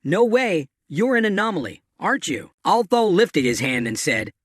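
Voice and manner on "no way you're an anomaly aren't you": usual speaking voice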